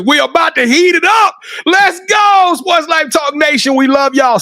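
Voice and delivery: rally the nation, all energy; hype outro. Real, not acted.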